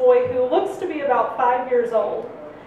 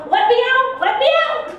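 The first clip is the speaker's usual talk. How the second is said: higher and louder, panicked yelling; mock yell; 11 semitones higher, 4 dB louder than usual. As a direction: yell to get off the ride. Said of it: "I'm yelling".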